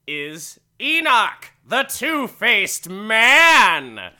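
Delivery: very loud, high, hugely drawn-out billing; booming announcer. Acted. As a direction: belt out the headliner's introduction. Repeats the billing, even bigger.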